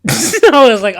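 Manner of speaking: laughs through the words; laughing. Real, not acted. Laughing while retelling roasting his mom.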